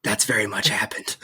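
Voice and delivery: whispered, soft and breathy; playful conspiratorial whisper. Pretend. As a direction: whisper it so she won't hear. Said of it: "I'm whispering".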